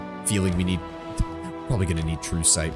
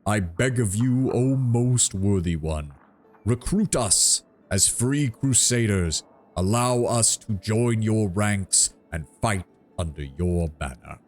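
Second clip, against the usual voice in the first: slow, solemn tree giant; treant voice; much slower than usual. Acted. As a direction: draw each word out like an ancient tree. Treant pleads.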